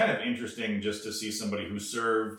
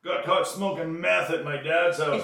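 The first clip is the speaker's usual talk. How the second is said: high, pleading excuse voice; mock whiny excuse; 7 semitones higher, 4 dB louder than usual. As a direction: shift the blame with a whine. Mock excuse-making confession.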